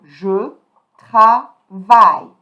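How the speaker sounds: "Je travaille" in slow, loud model French; silent final E. Shown as a demonstration. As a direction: end "travaille" on a strong "vai", E silent. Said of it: "you put the E here".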